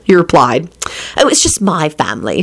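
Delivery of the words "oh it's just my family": usual speaking voice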